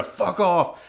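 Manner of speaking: retold yell. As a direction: snap it out like the drunk guy. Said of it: "So he yelled".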